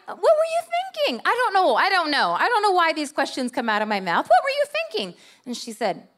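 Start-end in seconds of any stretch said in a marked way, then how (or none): 0.1-1.2 much higher, exaggerated disbelief; incredulous question. Wife asking the stranger why she slapped her neighbor, in high disbelief. 4.3-5.1 much higher, exaggerated disbelief; incredulous question. Wife asking the stranger why she slapped her neighbor, in high disbelief.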